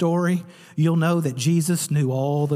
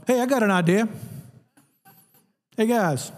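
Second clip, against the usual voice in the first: high, breezy interruption; eager Peter; 5 semitones higher than usual. Acted. Peter butting into the holy conversation, high and breezy. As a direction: toss it off brightly. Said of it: "the audacity".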